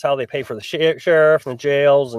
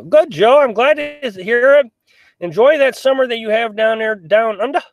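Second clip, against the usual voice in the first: put-on Australian accent; 8 semitones higher, 3 dB louder than usual. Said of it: "I had your accent just for a minute".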